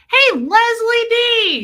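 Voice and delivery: high, drawn-out holler; loud, excited call-out; shouted greeting. Real, not acted. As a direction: shout a big slow hello. Calling out greeting to a viewer.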